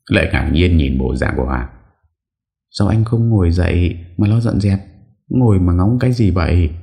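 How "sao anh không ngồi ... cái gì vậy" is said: put-on higher young woman, mildly impatient; lightly voiced young maid, mildly exasperated. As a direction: nudge him, a touch impatient and higher.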